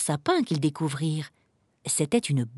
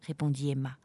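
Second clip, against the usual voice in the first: plain reading voice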